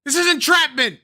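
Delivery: loud, high hammed-up protest; mock outrage. Streamer jokingly protests being baited, in loud mock outrage. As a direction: cry foul theatrically.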